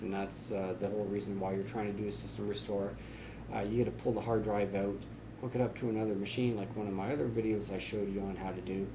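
normal talking voice

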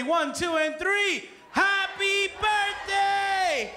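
stretched party-host yell; crowd-leading shout. Real, not acted. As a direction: count climbing into a long, high, shouted happy birthday.

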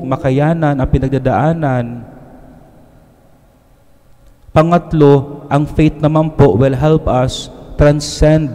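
usual speaking voice

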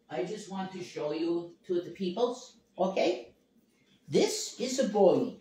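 sing-song pet-owner coo; pet talk. Acted. Showing her cat on stream.

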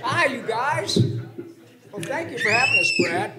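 high, fast cartoon mouse voice; chirpy goofy sidekick; Pinky voice. Stays in Pinky voice.

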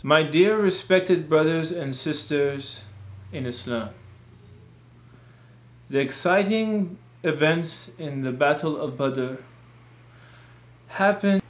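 slow, monotone preacher. Shown as a demonstration. Khatib addressing brothers and sisters in a slow monotone. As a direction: drone through it slowly with gaps between phrases. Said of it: "try not to speak in a monotone voice".

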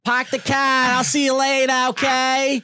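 high, slow, hammed-up Boston girlfriend accent; mock Boston accent. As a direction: ham up a whiny Boston accent. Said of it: "she would have that annoying ass accent".

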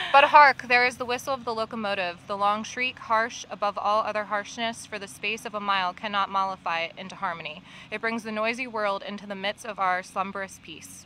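usual speaking voice

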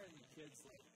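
ordinary talk

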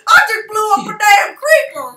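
loud, thrilled yell; excited shout. Real, not acted. Gamer yells at his friend after blowing up a creeper. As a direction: shout it out in real surprise.

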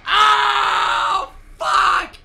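loud high frantic screams; screaming. Real, not acted. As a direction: yell your lungs out. Screaming during chase.

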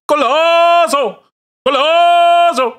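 high, drawn-out screaming GOLAZO; mock commentator scream, slow and very high. Shown as a demonstration. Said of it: "Am I supposed to say".

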